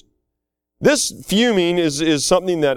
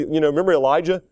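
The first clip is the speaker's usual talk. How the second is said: ordinary talk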